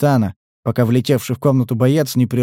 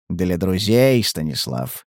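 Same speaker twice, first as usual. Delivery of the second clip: smirking; 7 semitones lower, slower than usual. A narrator's reading. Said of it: "ухмыльнулся охотник".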